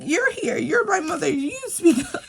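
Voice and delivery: high-pitched mock kid protest; imitated protesting daughter. Daughter telling mother to do the talking, high imitated protest. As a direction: push back like an indignant child, pitched well up.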